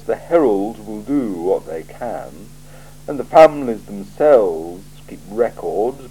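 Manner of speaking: a cool ruler weighing every word; icy, deliberate interrogator; Lord Vetinari. Acted. Vetinari dry reproach.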